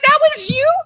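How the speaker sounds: high, loud incredulous rise; shocked to learn who it was; realizing the prankster was her friend; surprised. Retold or imitated. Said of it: "And I was like".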